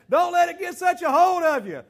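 ordinary delivery

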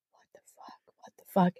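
hushed frightened cursing; scared whisper. Retold or imitated. Narrator in bed, whispering in fear. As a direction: whisper it terrified in the dark.

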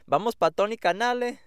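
mimicking parents announcing the trip to the park, Spanish-accented call. Son imitating his parents heading to the park, Spanish-accented excited call. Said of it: "That's what my parents would say".

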